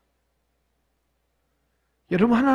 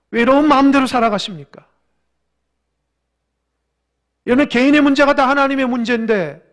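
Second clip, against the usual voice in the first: loud, urgent challenge from the pulpit; forceful preaching; 4 semitones higher, 9 dB louder than usual. Real, not acted. Preacher challenges congregation on belief, loud and insistent. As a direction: press the congregation with raised voice.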